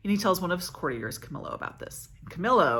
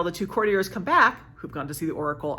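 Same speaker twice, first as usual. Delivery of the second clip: ordinary talk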